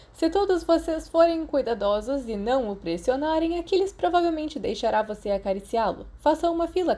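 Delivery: gently coaching the class; friendly teacher. A narrator's reading. Teacher Ferox voiced in story. (professor simpático, voz levemente mais aguda)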